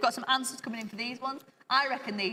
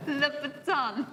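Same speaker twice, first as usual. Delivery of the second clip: put-on slow, higher, playful French accent; 5 semitones higher, much slower than usual. Repeating the shoe brand on request, drawn-out French accent. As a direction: draw it out in a mock French accent. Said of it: "Can you do that again".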